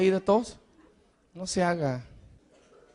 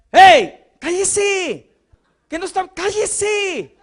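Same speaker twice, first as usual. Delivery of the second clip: shushing crowd; 9 semitones higher, 6 dB louder, much slower than usual. Retold or imitated. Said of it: "lo querían callar".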